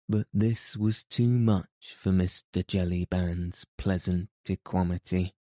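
usual speaking voice

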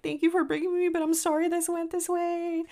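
high-pitched apologetic quote. Retold or imitated. Guest to the friend who paid, high-pitched apology. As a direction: pitch it well up, as a sorry thank-you.

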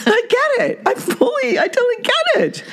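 very high, mock-tearful falsetto; squeaky self-parody. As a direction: play it as a tiny squeaky whimper. Carries on self-mocking squeaky voice.

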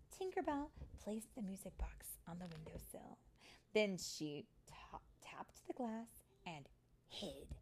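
expressive reading with dramatic pauses; lilting storytime voice; story narration for children. A narrator's reading.

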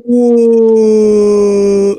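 imitating Harry's whoa. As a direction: mimic a tripping man marvelling at a noise. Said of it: "he's like".

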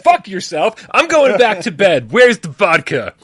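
high, loud, slow mock snarl; laughing fake grouch; hammed-up grumpy reply; mock grumpy outburst. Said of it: "I'm gonna be like".

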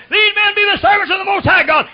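high, loud shrill mock of the damsel; possessed woman's cry. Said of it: "Here come an old gal say".

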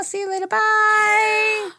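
very high, drawn-out goodbye; singsong sign-off. Acted. Host finishes reading a listener's email, high singsong goodbye. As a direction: let the farewell soar up high.

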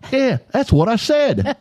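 slow, high mock Australian accent; thick Australian accent. Friend insisting he was understood, drawn-out Australian accent. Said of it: "his Australian accent is so thick".

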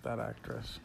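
ordinary talk

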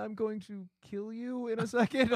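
high-pitched soothing of a hurt pet; consoling. Acted. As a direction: comfort the cat in a high, cooing voice. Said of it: "I'm trying to console you".

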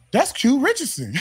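high-pitched imitation of amazed recognition; startled recognition. First officer spotting the player, startled. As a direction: blurt it out as if spotting someone famous.

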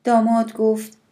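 ordinary delivery

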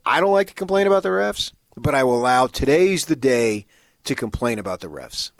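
loud and high, building indignation; worked-up rant. Real, not acted. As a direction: raise your voice to vent. Fan venting about the refs.

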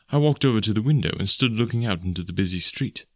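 plain reading voice

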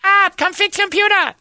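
very high, loud shrill calling; screaming waitress. Retold or imitated. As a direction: screech for help from across the room. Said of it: "She was the one that always screamed".

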